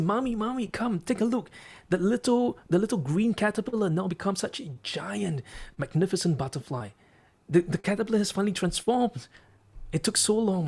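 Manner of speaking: put-on high-pitched, excited child voice; elated little boy. Boy calling Mommy to see the butterfly, elated and high. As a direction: call out in delight without really yelling. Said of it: "being very elated, very excited, screamed and yelled".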